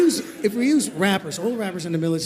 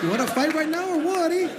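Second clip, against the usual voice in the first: mock tough challenge; 9 semitones higher than usual.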